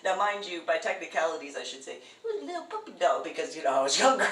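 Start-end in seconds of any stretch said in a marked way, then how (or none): 2.2-3.2 put-on high, fast cooing baby talk; talking to a dog. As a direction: squeak it like sweet-talking a pup.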